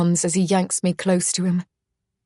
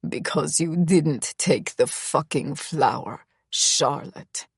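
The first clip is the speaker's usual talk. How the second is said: lower, rough croaking male voice; 3 semitones lower, 3 dB quieter, slower than usual. A narrator's reading. Misha accusing Charlotte, a low croak. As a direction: croak it out, raw and bitter. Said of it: "he croaks".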